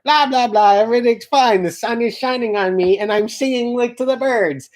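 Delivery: mock singsong carefree. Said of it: "She's like".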